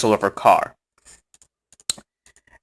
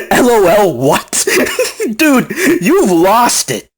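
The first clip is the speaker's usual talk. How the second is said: laughing disbelief; 5 semitones higher, slower than usual. Real, not acted. Laughing at his reply.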